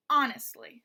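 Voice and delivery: "honestly" with a loud first syllable; stress on HON. Shown as a demonstration. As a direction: punch the "hon" in "honestly", let "estly" fall away. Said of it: "that first syllable is stressed".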